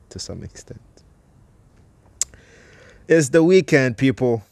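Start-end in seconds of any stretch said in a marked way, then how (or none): 3.1-4.4 excited. Real, not acted. Excited weekend greeting.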